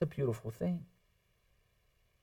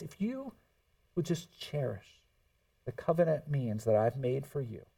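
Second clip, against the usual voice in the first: softer and lower, gentle and sincere; God's tender reassurance; 5 semitones lower, 6 dB quieter than usual. Acted. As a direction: speak it as comfort to a sinner. God's reassuring promise quoted.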